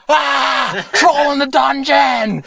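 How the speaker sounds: very high, drawn-out panicked shout; flipping out. Acted. Rapper flipping out at the troll, panicked shout. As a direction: scream the alarm, stretching the words. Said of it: "D M X is flipping out".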